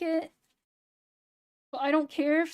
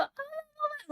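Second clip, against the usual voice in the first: a much higher breathy "Ah" of mock excitement; bubbly YouTuber gasp; 13 semitones higher, 7 dB quieter than usual. Shown as a demonstration. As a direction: squeal "Ah" way up like a hyped YouTuber. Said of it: "I don't know how people do the".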